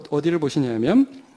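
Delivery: ordinary talk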